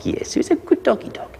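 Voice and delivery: put-on high, gushing baby-talk praise. Shown as a demonstration. Trainer coos praise at the good puppy, high baby talk. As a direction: coo at the puppy.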